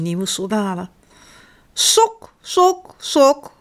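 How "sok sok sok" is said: high, repeated little calls; child copying mum's hen-calling. A narrator's reading. Bart follows mama hunting his sock, calling high. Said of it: "roept hij".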